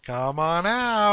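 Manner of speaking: slow, drawn-out creepy taunt; lightly voiced villain calling out to his prey; hint of a menacing singsong; mock taunting call. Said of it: "I love it when he was".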